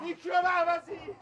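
high, loud shout of pain and anger. Acted. Majid getting sprayed, shouting high in pain. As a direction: scream it out as the spray hits.

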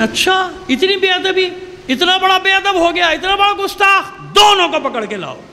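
high, rising outraged exclamations; an angry governor who can't believe the insolence; indignant outrage. Retold or imitated.

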